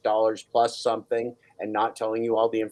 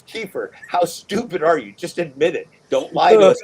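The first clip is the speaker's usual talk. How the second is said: mock yelling; 4 semitones higher, 7 dB louder than usual. Mock interrogation continues.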